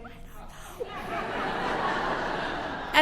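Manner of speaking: voiceless, teasing whisper; imitating a barely audible answer; whispered reply. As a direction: whisper it back from across the room. Boyfriend's silent teasing reply across room.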